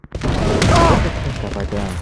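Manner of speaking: stunned guilt. Acted. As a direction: whisper it to yourself in shock. After injuring opponent.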